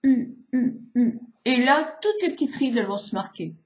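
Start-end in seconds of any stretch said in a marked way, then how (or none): 0.0-1.2 U said through tightly pushed-out lips; U with lips in a tight pout; pursed U. Shown as a demonstration. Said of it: "faire un peu un cul de poule".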